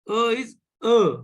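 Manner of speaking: E said as "uh"; French letter E. Shown as a demonstration. Teacher recites French vowels, E as "uh". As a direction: say E as a rounded French "uh". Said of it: "in French, it is A".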